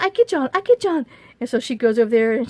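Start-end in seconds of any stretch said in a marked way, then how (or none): 0.0-1.1 put-on higher, louder broken-English Chinese accent; imitating Bunny's Chinese accent. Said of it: "she still has her little accent and stuff".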